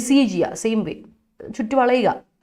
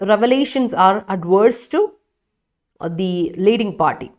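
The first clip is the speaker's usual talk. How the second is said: slow reading; much slower than usual. Shown as a demonstration. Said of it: "reading student sentence slowly".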